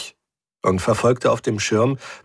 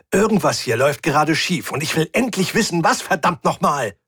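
higher-pitched, agitated outburst; exasperated insistence; 6 semitones higher than usual. Acted. Rick demanding the truth from his doubting crew, exasperated. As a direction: insist heatedly, at the end of patience. Rick argues, losing patience.

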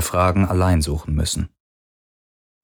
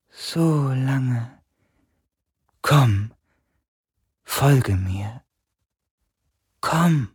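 high, slow, drawn-out otherworldly voice; dying spirit's voice; 6 semitones higher, much slower than usual. A narrator's reading. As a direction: stretch it out faintly, like a fading spirit. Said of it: "sagte die Stimme". (hohe, gedehnte Geisterstimme)

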